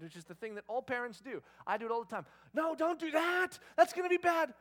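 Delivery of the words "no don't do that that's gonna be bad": mock parental warning. Parent to three-year-old about to act up, loud mock warning. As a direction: overdo a parent's alarmed no. Exasperated warning to small child.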